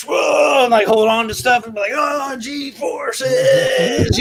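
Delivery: high, laughing mock yell; high laughing yell of kids pretending to fly; mock G-force shout. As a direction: ham up being thrown around by G-forces. Kids acting thrown by G-forces.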